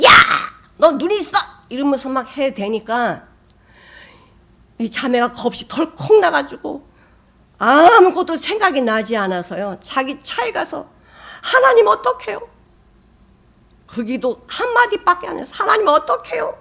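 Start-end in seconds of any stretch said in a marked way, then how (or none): 0.0-1.4 high, loud angry yell; ringing yell. Retold or imitated. Angry driver yelling at the sister after the crash. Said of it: "쩌렁쩌렁한 목소리에". 11.4-12.5 much higher, louder frightened plea; panicked prayer. Retold or imitated. Scared sister in her car, panicked plea to God. 15.5-16.6 much higher, louder frightened plea; panicked prayer. Retold or imitated. Scared sister in her car, panicked plea to God.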